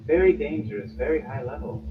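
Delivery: know-it-all mage musing quietly; lightly voiced young mage. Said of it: "young mage woman walks past going".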